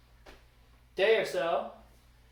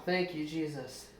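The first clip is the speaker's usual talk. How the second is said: quiet thanks; 2 semitones lower, 6 dB quieter than usual. Real, not acted. Quiet thanks after the excitement.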